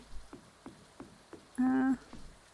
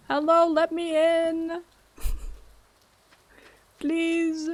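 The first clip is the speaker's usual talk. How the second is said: calling out; 5 semitones higher, 3 dB louder, much slower than usual. Pretend. Calling at a locked door.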